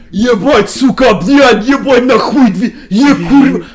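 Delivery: high, loud barrage of Slavic swearing; hammed-up raging soldier yell; mock fanatical cursing. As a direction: play a frenzied enemy soldier hurling curses. Acts fanatical enemy swearing at him.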